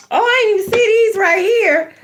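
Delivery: sudden oh of surprise; surprised. Real, not acted. Reader spotting cards she missed, surprised.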